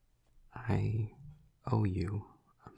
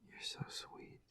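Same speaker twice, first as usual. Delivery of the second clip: very soft, whispery and touched; almost whisper it to someone dear; hushed, grateful tenderness; tender murmur; 3 semitones higher, 6 dB quieter than usual. Acted. Tenderly thanks forgiving listener.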